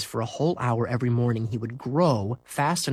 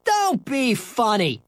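high, scoffing dismissal; laughing; 11 semitones higher, 3 dB louder, slower than usual. Acted. Scooter brushing off Henry's brag, amused scoff. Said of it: "Scooter laughed".

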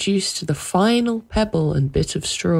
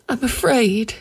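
high-pitched, frightened admission; afraid; 8 semitones higher than usual. A narrator's reading. Wife admitting fear to her husband, frightened. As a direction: confess the fear in a raised pitch.

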